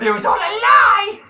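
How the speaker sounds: high, loud mock crying wail; overacted despairing shout at the island; hammed-up tearful cry of betrayal; imitating Claire. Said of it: "and then Claire goes".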